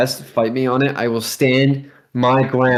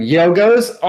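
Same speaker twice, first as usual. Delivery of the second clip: excited; 7 semitones higher, 6 dB louder, slower than usual. Real, not acted. Excitedly recalling a snack.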